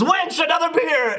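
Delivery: high, slow, commanding call; shouted tavern demand. Retold or imitated. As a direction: demand a beer like a lord. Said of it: "Jeremy goes".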